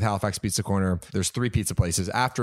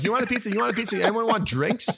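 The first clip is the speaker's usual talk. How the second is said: cheerful offer; 10 semitones higher than usual. Retold or imitated. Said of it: "I'm like".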